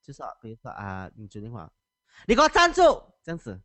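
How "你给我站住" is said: a high, loud shout of stand right there; furious teacher shout. Shown as a demonstration. Teacher demoing how she yells at a pupil, loud shout. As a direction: bark it at a runaway pupil, much louder. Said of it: "表演一下".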